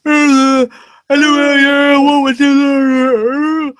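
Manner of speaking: high, loud put-on voice for the Shell; imitating the Shell. Mimicking the Shell's morning greeting, high and loud. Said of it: "she goes".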